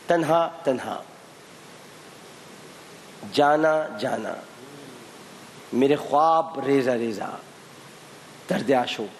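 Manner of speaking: drawn out, each word spaced; slow, deliberate clue reading. Acted. Quiz host to contestants, reading clues slowly. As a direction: read the clues like a puzzle.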